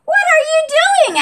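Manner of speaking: high, loud squeaky Bunny. Acted. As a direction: pop up high as bossy Bunny. Bunny questions Benny.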